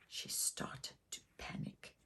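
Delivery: hushed, breathy whisper of panic. A narrator's reading. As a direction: drop to a tense whisper. Narrator whispers panic moment.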